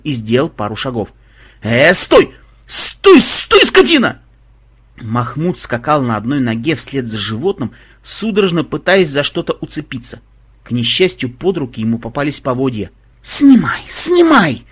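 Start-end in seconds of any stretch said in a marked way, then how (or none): 1.6-4.2 high, loud shout at a runaway; panicked yell. Acted. Mahmud hopping after his horse, panicked high yell. 13.2-14.6 gleeful high, loud shout; delighted yell. Acted. Ksenobait urging the girl to keep filming, delighted yell. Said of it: "в восторге завопил внучке Ксенобайт".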